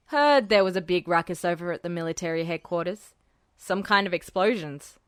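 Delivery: low casual gossiping voice; Hecht fishing. Acted.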